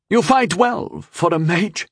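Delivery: high, slow, slightly winded concession; breathless admission. A narrator's reading. Knight mid-duel to his mage opponent, breathless. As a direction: admit it as if winded.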